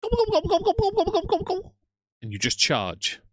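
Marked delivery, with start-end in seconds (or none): 0.0-1.8 high, loud turkey gobbling; turkey war call. Acted. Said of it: "Your war cr-, call is".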